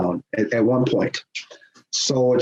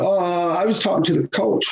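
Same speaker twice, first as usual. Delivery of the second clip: sheepish excuse; 8 semitones higher than usual. Retold or imitated. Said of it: "he looked dumbfounded".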